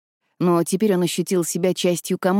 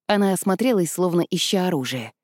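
plain reading voice

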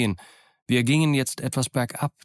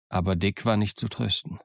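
ordinary talk, nothing marked

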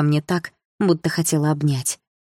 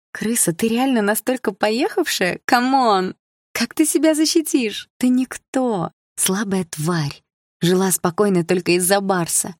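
put-on higher, sneering bully girl; higher mocking schoolgirl threat; mean girl voice; 4 semitones higher than usual. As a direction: taunt her from close up.

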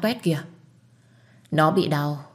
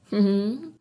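short smug chuckle; mother-in-law laughing at the wife, smug; satisfied mocking laugh; 5 semitones higher than usual. A narrator's reading. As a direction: laugh at her in triumph. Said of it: "nụ cười rất hài lòng, nhìn tôi chế giễu".

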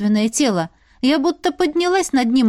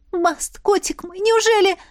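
frightened; 9 semitones higher than usual. Acted. Heroine fears cat is dead.

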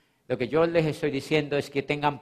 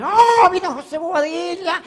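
agudo y fuerte, voz de mujer entusiasta; mujer efusiva imitada; 12 semitones higher, 7 dB louder than usual. Retold or imitated. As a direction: saluda a gritos con alegría. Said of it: "siempre que me la encuentro". (la puertorriqueña lo recibe eufórica, agudo y fuerte)

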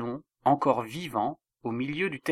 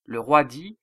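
plain reading voice

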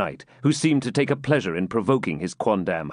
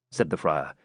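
ordinary delivery, nothing marked

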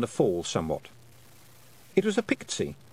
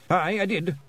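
Scottish pixie voice; 9 semitones higher, 3 dB louder than usual. Acted. Hamish answers.

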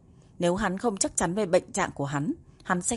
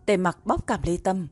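plain reading voice

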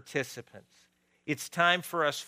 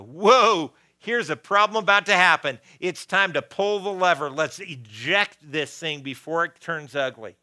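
loud, higher panicky "whoa", easing as it goes; alarmed disciples; 3 semitones higher, 8 dB louder than usual. Acted. Disciples reacting to the huge crowd, alarmed. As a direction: sound the alarm, then drag out the last words.

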